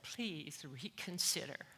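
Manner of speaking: soft, slow retold plea; understated pleading, imitating the husband; begging. Husband begging his wife not to divorce, softly pleading. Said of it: "and he begged".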